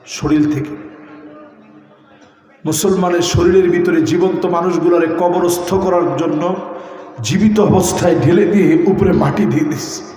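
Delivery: ordinary talk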